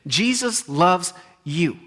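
very slow, each word weighed; slow right down and let it land; slow deliberate emphasis. Real, not acted.

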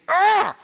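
sudden high angry yelp; a sharp, high cry of frustration; angry outburst yelp. Retold or imitated. Preacher mimics an angry believer, high yelp.

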